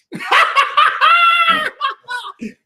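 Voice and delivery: loud, high mocking laugh; loud burst of laughter. Real, not acted. Reacting to [name]'s "it comes natural", loud mocking laugh. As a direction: crack up in disbelief.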